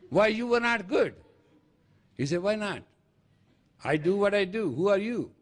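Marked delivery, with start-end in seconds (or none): 0.1-1.1 high-pitched complaint; mock yelling. Speaker confronting the weather over bad cherries, high mock yell. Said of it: "Don't yell at me". 2.4-5.3 high-pitched, challenging comeback; defiant retort. Acted. Weather answering the complaining man, defiant high retort.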